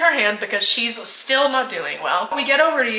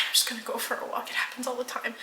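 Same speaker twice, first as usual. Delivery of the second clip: fast, whispered, almost to herself; breathy dazed whisper; 12 dB quieter, much faster than usual. Retold or imitated. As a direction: rush it out under your breath. Dazed friend insisting on walk.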